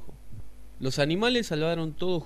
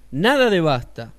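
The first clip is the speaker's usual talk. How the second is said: grito del Piojo; 4 semitones higher, 12 dB louder than usual. Acted. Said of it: "gritó el Piojo".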